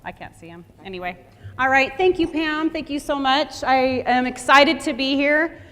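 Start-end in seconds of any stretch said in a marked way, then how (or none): 1.6-5.6 booming welcome to the room; projecting loudly to the whole audience; real loud opening greeting. Real, not acted. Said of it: "I have a loud voice".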